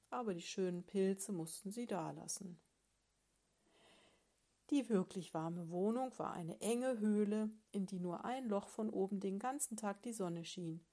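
ordinary delivery

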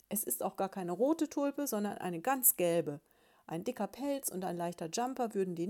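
loud, fed-up rant at his nagging wife; shouting. Acted. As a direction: keep yelling your whole answer. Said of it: "schrie Balduin Brumsel". (laute, genervte Schimpftirade)

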